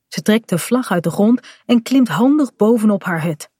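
ordinary delivery, nothing marked

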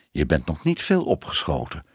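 put-on low, disapproving old aunt. A narrator's reading. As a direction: size her up with mild disapproval. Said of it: "zei tante Moortje misprijzend".

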